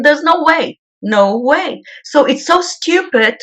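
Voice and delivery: normal talking voice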